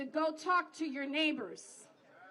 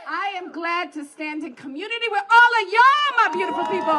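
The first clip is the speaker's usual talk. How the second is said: shouting to crowd; 11 dB louder than usual. Real, not acted.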